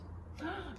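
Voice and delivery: a quick soft gasp of wonder; a little breath in, amazed; delighted gasp. A narrator's reading. Child gasps at the story, soft delighted gasp.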